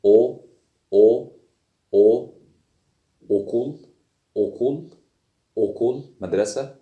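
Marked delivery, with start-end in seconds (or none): 0.1-2.3 "أو" as a loud rounded o; Turkish o demo. Shown as a demonstration. Teacher reviews the third letter, loud isolated o. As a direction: punch out each isolated "أو" as plain o. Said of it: "تالت حرف".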